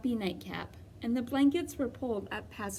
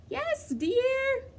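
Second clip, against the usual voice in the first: much higher, croaky old granny put-on; wolf pretending to be a sick grandmother; high fake-granny croak; scratchy voice; 4 dB louder than usual. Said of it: "came a scratchy voice".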